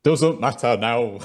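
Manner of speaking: put-on slow, comic northern accent; bad northern accent, slightly high. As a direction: draw it out in hammed-up northern English. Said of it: "apologize for the accent".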